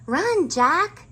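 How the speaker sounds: high, loud call to run; urgent shout. A narrator's reading. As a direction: yell it like she's really scared. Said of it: "said Annie".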